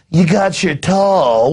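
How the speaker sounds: a hint of a snooty barista, slow; lightly voiced trendy clerk drawing it out; mock trendy barista. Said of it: "have the trendy behind the counter go".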